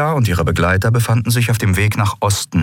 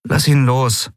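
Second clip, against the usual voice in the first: tired repeat of the order; weary Endriel; 7 semitones higher, slower than usual. Acted. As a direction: say it wearily, give up. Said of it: "sagte Endriel matt".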